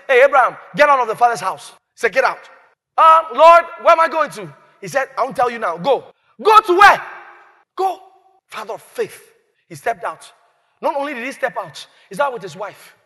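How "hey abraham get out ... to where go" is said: loud, bossy order; commanding call. Acted. God ordering Abraham out of his father's house.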